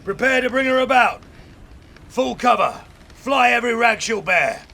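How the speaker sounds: high, loud command voice; captain's orders. Acted. Captain ordering the crew to come about, loud high commands. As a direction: bark the orders across the deck.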